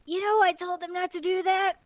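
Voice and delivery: smooth over the mess politely; lightly voiced government boss; apologetic boss. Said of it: "he's like".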